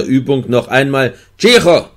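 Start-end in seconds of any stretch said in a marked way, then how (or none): 1.4-1.9 höher und lauter gerufen; Ruf. A narrator's reading. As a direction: knapp aus Gewohnheit gerufen. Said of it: "Und er rief". (Wärter ruft aus Gewohnheit, laut)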